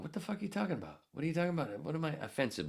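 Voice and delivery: high, fast disbelief; retold protest, mock high incredulity; incredulous self. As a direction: throw the question back, baffled. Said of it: "As I was going".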